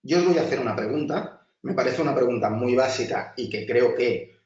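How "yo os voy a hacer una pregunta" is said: usual speaking voice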